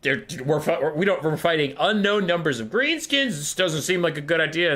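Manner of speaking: high, anxious henchman voice; worried warlock engineer. Acted. Warlock engineer friend objecting to the boss, high-pitched worry. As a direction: plead nervously in a high voice. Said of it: "his warlock engineer friend goes".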